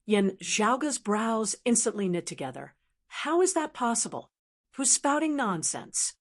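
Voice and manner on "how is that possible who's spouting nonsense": higher-pitched, incredulous questions; indignant disbelief. A narrator's reading. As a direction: react to an accusation with raised-pitch disbelief. Accused hero rejects a rumour.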